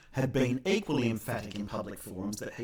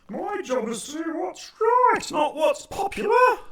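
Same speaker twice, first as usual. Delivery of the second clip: high, slow, loud mock politician; hammed-up self-righteous politician shouting; pompous politician; 9 dB louder than usual. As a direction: politician declaiming his noble duty, hammed up. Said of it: "When a politician says something like".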